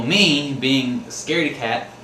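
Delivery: usual speaking voice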